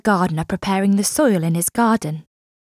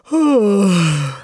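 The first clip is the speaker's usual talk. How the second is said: mocking laugh. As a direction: laugh at his foolishness. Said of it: "they laughed".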